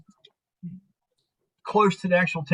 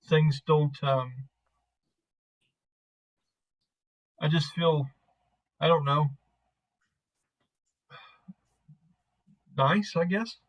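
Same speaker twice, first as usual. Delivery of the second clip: halting, unsure; 3 dB quieter than usual. Acted. Man struggling to explain his feelings to her, halting. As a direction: fumble softly for the words, with long pauses. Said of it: "that's the best he explains it".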